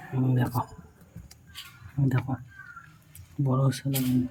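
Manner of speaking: very soft, careful murmur; hushed voice. Real, not acted. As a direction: keep it hushed so it won't echo. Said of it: "সেজন্য আস্তে আস্তে কথা বলছি".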